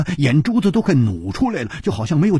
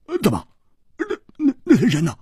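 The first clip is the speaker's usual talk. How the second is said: tense stammer; 9 semitones higher, much faster than usual. A narrator's reading. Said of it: "胡铁花也紧张起来了".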